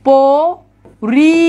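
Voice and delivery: পরি extremely slow, split as প and রি; sounded-out syllables. Shown as a demonstration. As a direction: stretch both halves of পরি with a long gap. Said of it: "নাকি প্রথমটা উচ্চারণ".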